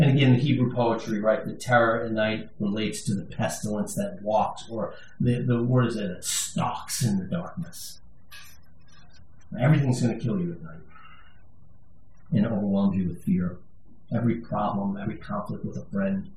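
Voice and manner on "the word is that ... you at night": ordinary talk, nothing marked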